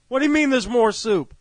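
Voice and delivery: loud, high incredulous demand; exasperated caller. Acted. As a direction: demand an explanation in mock exasperation.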